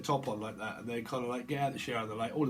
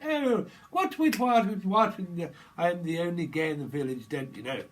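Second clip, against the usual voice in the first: hammed-up Little Britain voice; 3 semitones higher, 5 dB louder than usual. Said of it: "you've seen Little Britain".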